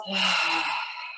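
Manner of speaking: long breathy sigh out the mouth; audible exhale. Shown as a demonstration. Meditation guide showing the class how to release, audible exhale. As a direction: let all the air go in one audible sigh. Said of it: "exhale out the mouth".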